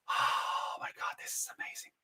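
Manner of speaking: imitated hushed wonder; awed whisper. Awed guests on smooth boat ride.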